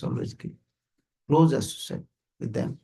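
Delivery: usual speaking voice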